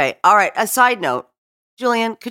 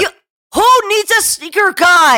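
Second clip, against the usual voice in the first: incredulous raised-voice question; indignant; 12 semitones higher, 5 dB louder than usual. Real, not acted.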